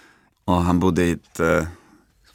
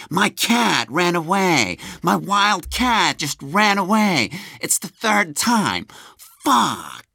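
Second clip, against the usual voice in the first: much higher, slow, distraught eccentric voice; Nick Cage impression; 11 semitones higher, slower than usual. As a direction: wail it like a man whose wild cat fled again. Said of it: "Nick är bara så här". (mycket hög, långsam och förtvivlad Nick Cage-röst)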